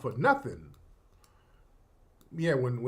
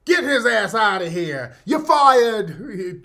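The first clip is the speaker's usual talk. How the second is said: loud, higher-pitched bellow at a crowd; mock Trump outburst; 5 semitones higher, 8 dB louder, slower than usual. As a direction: bark it like an angry Trump. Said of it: "He starts going on again on him".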